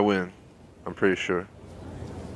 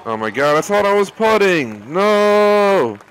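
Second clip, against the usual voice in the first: loud rising cry of disbelief; shocked yell; 11 semitones higher, 6 dB louder, slower than usual. Real, not acted. Putt rolls way off green.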